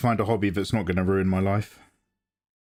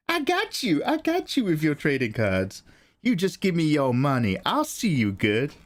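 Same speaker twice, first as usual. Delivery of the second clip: Nathan; 3 semitones higher than usual. Acted. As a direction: reassure like a sweet-talking dealer, high at first. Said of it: "that's what Nathan sounds like".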